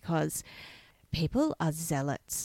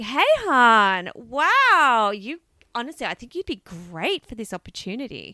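loud, high, gushing hey-hon voice; over-the-top fake friendly recruiter; mlm recruiter pitch; 4 semitones higher, 7 dB louder than usual. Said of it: "will suddenly be like".

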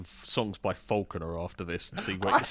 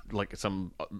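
usual speaking voice

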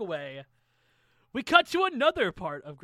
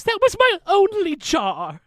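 high, loud, slow wail over the jar; high, loud, slow outrage; outraged cry; 11 semitones higher, 10 dB louder, slower than usual. Acted. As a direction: cry out at the loss. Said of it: "He goes".

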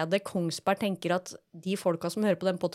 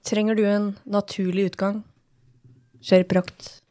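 ad read; 4 dB louder than usual. Pretend. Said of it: "Følg med nå".

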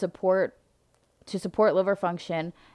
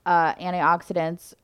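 ordinary delivery

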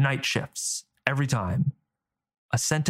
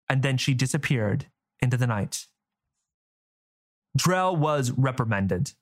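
plain reading voice

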